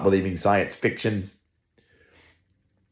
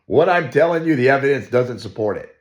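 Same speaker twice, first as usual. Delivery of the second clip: higher, louder mocking paraphrase of Darwin; mock Darwin confession; 6 semitones higher, 5 dB louder, faster than usual. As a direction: lampoon Darwin admitting defeat. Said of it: "Darwin saying".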